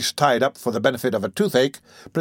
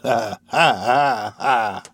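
put-on sly old man laugh; much slower than usual.

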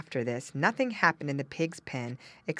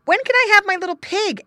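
put-on high, loud child's question; eager boy; 12 dB louder, faster than usual. Boy asking father for his pig, eager. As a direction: ask with impatient boyish excitement.

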